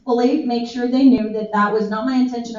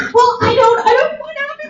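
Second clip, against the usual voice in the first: imitating Jared; 12 semitones higher, faster than usual. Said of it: "He was like".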